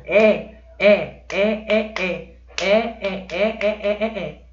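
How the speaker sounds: é é é pounded out in a rhythmic chant; teasing chant of é; playful repetition. Shown as a demonstration. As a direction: hammer the word é over and over. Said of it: "Fiz uma brincadeirinha aqui".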